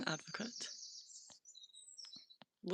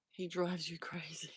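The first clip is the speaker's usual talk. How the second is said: whisper; 3 semitones lower, 8 dB quieter than usual. Real, not acted.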